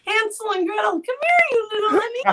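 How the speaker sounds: high-pitched mock fairy-tale call; playful child-luring villain. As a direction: lure the children in a high, hammed-up voice. Playing the witch luring children.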